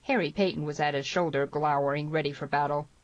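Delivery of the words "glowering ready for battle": plain reading voice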